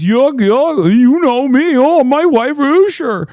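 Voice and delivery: high, loud hammed-up folksy voice; J D Vance folksy act. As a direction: ham up a fake down-home charm. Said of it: "J D Vance gets in there and goes".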